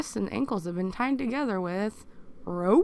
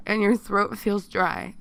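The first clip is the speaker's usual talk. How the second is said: ordinary delivery, nothing marked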